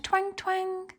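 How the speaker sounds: high, soft twang sounds; twanging web. Acted. The spider twanging her web in the story. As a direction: pluck the words like a web string. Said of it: "the spider twanged her web".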